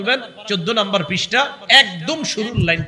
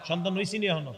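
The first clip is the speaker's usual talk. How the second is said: normal talking voice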